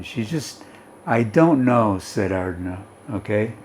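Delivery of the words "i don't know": normal talking voice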